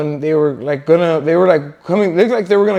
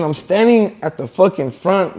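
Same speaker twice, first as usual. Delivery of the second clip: ordinary delivery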